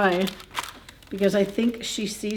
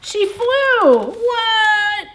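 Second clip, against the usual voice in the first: high, louder joyful surprise; excited reveal; 13 semitones higher, 7 dB louder, much slower than usual. A narrator's reading. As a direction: burst out with delight for the children. Triumphant reveal of flight.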